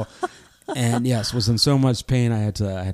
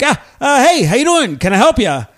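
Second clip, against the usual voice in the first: high, startled, overly friendly hello; flustered cheerful greeting; 9 semitones higher, 3 dB louder than usual. Retold or imitated. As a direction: cover the panic with bright cheer. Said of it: "I immediately dropped the accent".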